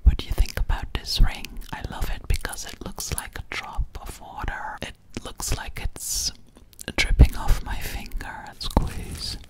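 ordinary talk, nothing marked

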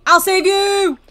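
high, loud rescue cry; playful shout. Pretend. Game heroine shouts a rescue.

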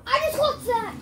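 high, loud victory yell; excited shout. Real, not acted. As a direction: shout it out in triumph. Just won a tight fight.

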